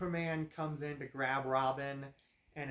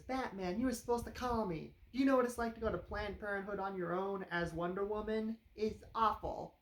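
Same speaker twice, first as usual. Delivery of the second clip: Wonder Woman complaint; 6 semitones higher than usual. Retold or imitated. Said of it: "comes in ... as Wonder Woman and says".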